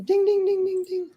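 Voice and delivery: high sung dings like a bell; vocal elevator ding. Acted. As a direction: chime like an elevator arriving. Imitates the elevator bell again.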